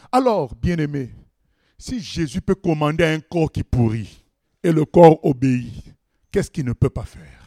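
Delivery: normal talking voice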